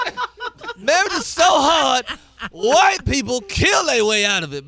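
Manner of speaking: Chris Rock impression. Comic does his Chris Rock voice for radio hosts. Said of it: "I feel like I can talk in his voice".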